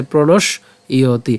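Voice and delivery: প্রণশ, a break, then ইয়তি, slowly; broken-up pronunciation. Shown as a demonstration. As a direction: say the glide as "iy" in ইয়তি. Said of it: "এটাকে ভেঙে উচ্চারণ করি".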